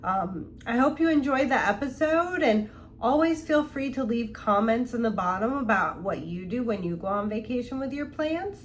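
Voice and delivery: usual speaking voice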